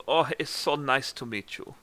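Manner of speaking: put-on hammy German-accented pleasantry; bad German accent. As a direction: greet in a badly faked German accent. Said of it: "we're gonna get bad German accents".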